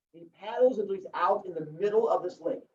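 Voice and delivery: ordinary talk, nothing marked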